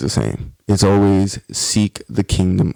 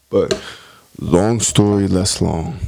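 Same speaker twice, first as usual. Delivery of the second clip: normal talking voice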